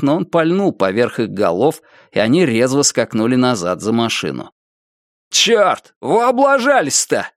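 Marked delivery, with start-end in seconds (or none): 5.3-7.3 displeased shout. A narrator's reading. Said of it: "недовольно выкрикнул кто-то".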